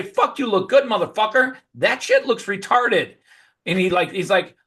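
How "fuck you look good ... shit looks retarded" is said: hammed-up jeer; pretend teasing. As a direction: rib the bald friend. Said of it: "I'd say".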